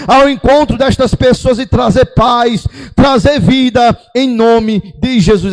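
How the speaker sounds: prayer building from measured to high, loud pleading; impassioned church prayer, rising to full force; fervent prayer. Real, not acted. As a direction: pray with mounting intensity, pitch climbing phrase by phrase. Leads congregation in fervent prayer.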